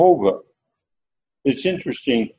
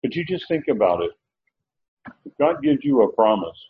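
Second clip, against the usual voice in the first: normal talking voice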